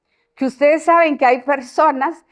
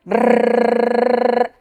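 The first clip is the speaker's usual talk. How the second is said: long trilled rrrr held out; sustained rolled R; rolled R demo. Shown as a demonstration. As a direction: buzz the tongue so the child feels it vibrate. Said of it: "sienta la vibración".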